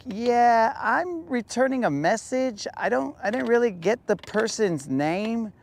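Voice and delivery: high, lightly voiced business caller; imitating the caller. The business calling him back, lightly voiced high caller. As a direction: pitch it up as the polite caller.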